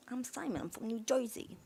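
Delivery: mock Jersey "Joizy" accent; New Jersey accent. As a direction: imitate Simon as a New Jersey local. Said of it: "Can you do a New Jersey accent".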